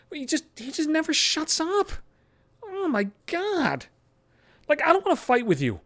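high-pitched exasperated whine, dragged out at the end. Real, not acted. Venting about a nonstop talker.

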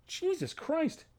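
drained disbelief, nearly breathed; exasperated groan. Real, not acted. Despair after outburst.

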